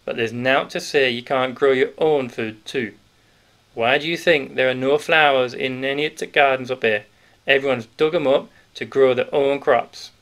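put-on a big grocer letting the children in on a secret; hearty Yorkshire grocer. Grocer voiced through the scene.

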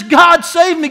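loud, high cry of "God save me"; a desperate prayer shouted aloud; shouted plea. Acted. Acted repentant cry.

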